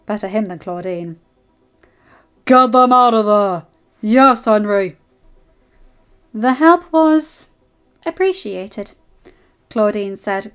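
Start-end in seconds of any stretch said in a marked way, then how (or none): 2.4-5.0 loud, forceful shout; called-out order. Acted. Gang members clearing out, calling loudly. 6.3-8.9 halting, reluctant thank you; guarded thanks. Acted. Claudine thanking Henry before an audience, guarded. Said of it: "the best thank you she could in front".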